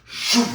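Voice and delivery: loud, high imitated whoosh of a door; door slam whoosh. Telling how the door slammed, a loud imitated whoosh. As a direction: make the sound of the door bursting open.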